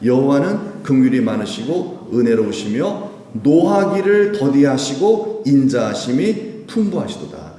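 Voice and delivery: measured, unhurried recitation; slow scripture reading. Shown as a demonstration. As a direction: read slowly, like scripture read aloud. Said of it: "읽어봅시다". (천천히 성경 봉독하듯)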